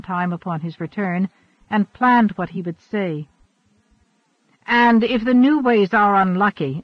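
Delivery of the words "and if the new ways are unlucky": louder, higher challenging exclamation; Red Hawk exclaiming. A narrator's reading. Follower bursting into the leaders' argument, exclaiming. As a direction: break in with a heated challenge. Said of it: "exclaimed Red Hawk".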